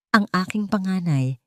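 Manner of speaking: ordinary delivery, nothing marked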